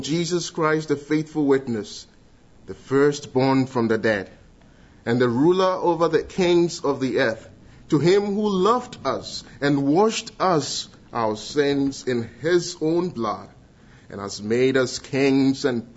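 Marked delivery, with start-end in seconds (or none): none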